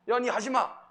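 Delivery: 높고 크게 웃으며 툭 내뱉는 반말; 거칠게 놀리듯 던져요; 무식한 반말 흉내. Retold or imitated. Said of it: "이래 하잖아요". (웃으며 거친 반말 흉내)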